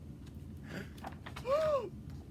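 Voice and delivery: sharp breathless gasp; shocked gasp. Acted. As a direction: gasp in alarm. Button pops in story.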